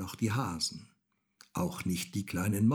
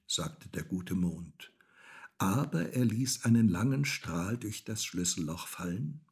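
ordinary delivery

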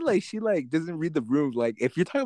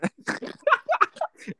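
very high, real giggle; giggling; 4 dB quieter than usual. Real, not acted. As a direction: giggle helplessly, high in pitch. Embarrassed by the flirty answer.

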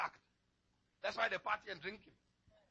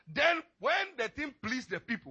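usual speaking voice

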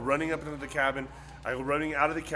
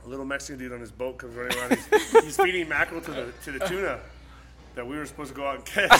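ordinary delivery

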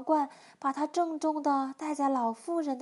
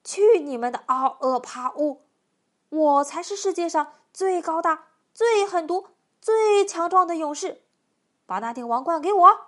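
loud, domineering giant; overbearing storybook bully demanding the prize; giant's shout; 3 semitones higher, 10 dB louder than usual. A narrator's reading. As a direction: boom out each boast forcefully. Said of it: "巨人凌空对他们三个 喊道".

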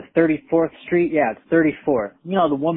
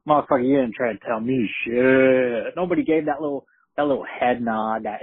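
usual speaking voice

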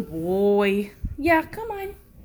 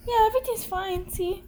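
coaxing pet talk; 9 semitones higher, 4 dB quieter than usual. Real, not acted. Owner inviting her dog up beside her, high cooing. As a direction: coo him over softly.

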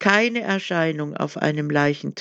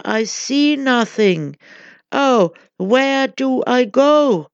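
high, pleading cry of despair; cried in despair; 7 semitones higher, 5 dB louder than usual. A narrator's reading. The blinded wanderer begs the crowd, despairing cry. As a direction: reach out lost and desperate. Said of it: "Then I cried in despair".

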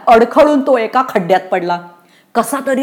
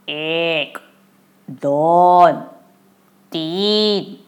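drawn-out count, one number at a time; eerie ghostly counting; 4 semitones lower, 3 dB quieter, much slower than usual. A narrator's reading. Narrator imitates the ghost counting mangoes in the tree, eerie slow count. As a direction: count slowly from up in the branches, long pauses between. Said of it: "असा आकडे मोजण्याचा आवाज".

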